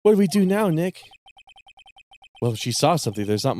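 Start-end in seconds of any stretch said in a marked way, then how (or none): 0.0-1.0 put-on higher, eager girl voice; perky sidekick tone; young girl voice.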